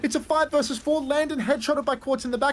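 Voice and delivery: high, building play-by-play call; esports caster. Real, not acted. Live play-by-play.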